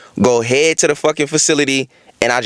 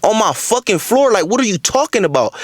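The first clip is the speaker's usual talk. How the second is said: higher-pitched, incredulous challenge; retold indignant confrontation; 7 semitones higher than usual. Telling off the woman who made the mess, indignant. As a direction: act out calling her out on it.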